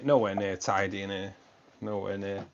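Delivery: ordinary talk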